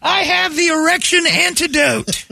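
very high, slow proclamation; mock herald. Host playing Brady as antidote herald, high mock proclamation. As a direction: stretch it out in a hammed-up high yell.

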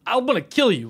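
mock threat. Rival fan attacking a Russian fan, mock furious.

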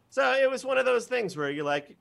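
ordinary talk, nothing marked

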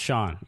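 "Sean" as one syllable, SHAWN, falling; correct pronunciation. Shown as a demonstration. Co-host accepting how the name is pronounced, one syllable. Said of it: "that's how it's pronounced".

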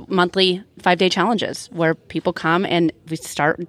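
usual speaking voice

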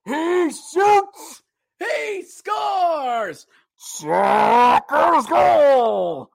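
booming drawn-out goal call; mock arena goal call, stretched out; mock arena announcer. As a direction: ham up the rival announcer. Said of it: "I hear it in Charlotte already".

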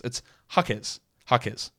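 Jaquez ending in a clear KEZ, said softly; settled pronunciation. Shown as a demonstration. Host settling how to pronounce a name, soft hah-KEZ for Jaquez. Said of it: "how do I pronounce Jaquez".

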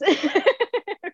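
laughing up high; bright burst of laughter. Real, not acted. Laugh after joke.

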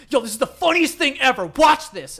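loud, mock-excited overselling; hyped-up hype. Friend overselling a funny video, mock-excited shout. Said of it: "really hyping it up here".

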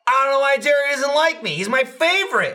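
high, loud mock-innocent voice, laughing; mock Jeff. Imitating Jeff's fake innocence about Jerry, high-pitched. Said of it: "he follows and goes".

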